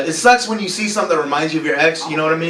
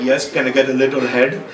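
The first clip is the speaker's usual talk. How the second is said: put-on lower, accented English as dad; immigrant dad's accent; 5 semitones lower than usual. Dad ordering at the drive-thru window, accented English. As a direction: imitate dad's accented polite request. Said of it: "dad who doesn't speak very good English".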